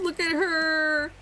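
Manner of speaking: high, slow, half-crying coo; cooing squeal. Real, not acted. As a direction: melt over something adorable, drawing it out. Gushing over cute villager.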